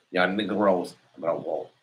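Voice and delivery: a slow, low, lightly put-on Spanish accent. Shown as a demonstration. To his viewers, repeating the line in a mild mock Spanish accent. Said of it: "That's the way it is in my Spanish voice".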